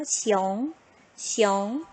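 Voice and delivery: 시용 in a rising second tone; Mandarin second tone. Shown as a demonstration. Demonstrating for pupils, 시용 in rising second tone. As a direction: let 시용 climb upward. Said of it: "성조가 이 성이니까 해볼게요".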